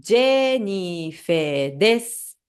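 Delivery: slow, careful Japanese self-introduction; slow demo. Shown as a demonstration. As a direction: spell out each syllable slowly. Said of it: "meu caso fica assim".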